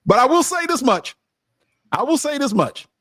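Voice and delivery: high-pitched, insistent proclamation; emphatic declaration. Acted. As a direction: pitch it way up to make the point. Emphatic high-pitched aside after punchline.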